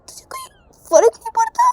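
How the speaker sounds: high, stunned whisper; hurt disbelief; shocked whisper. A narrator's reading. Di whispers in shock that Ravi doesn't care.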